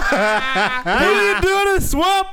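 drawn-out, high, loud swamp yell; Shrek-style yell. Pretend. Friend mocks a home intruder with a slow, high, loud yell. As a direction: bellow it slowly like an angry ogre.

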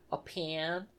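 PAN said for pin, Oklahoma style; mock Oklahoma vowel on pan; imitated Oklahoma accent. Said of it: "You got a funny accent".

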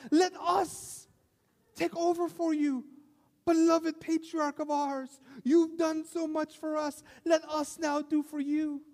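high, slow, overly formal and devoted; imagined dutiful daughters. Pretend. Daughters grandly offering to take over for sick father, high and slow. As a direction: play the ideal daughters with lofty reverence. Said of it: "I expected them to both rush out of the house and say".

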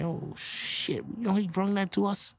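hushed, stunned whisper; breathless hushed realization; awed whisper. Retold or imitated. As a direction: whisper it in disbelief. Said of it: "I'm like".

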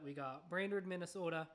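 lightly done Minnesota accent on Brainerd, Minnesota. Shown as a demonstration. Host reading a place name to listeners, in a light Minnesota accent. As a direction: say we got Brainerd, Minnesota with a hint of Minnesota vowels. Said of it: "I'm gonna subject y'all to the accent".

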